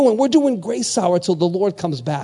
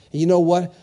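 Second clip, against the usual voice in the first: ordinary talk, nothing marked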